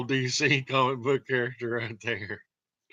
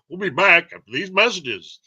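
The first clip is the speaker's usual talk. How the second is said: mock announcer; 5 semitones higher, 6 dB louder than usual.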